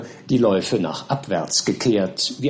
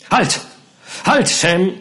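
high, urgent call to stop; 7 semitones higher, 3 dB louder, slower than usual. A narrator's reading. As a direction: call a sharp halt to your servant. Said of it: "rief Mister Pickwick".

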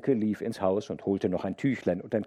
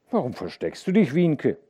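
usual speaking voice

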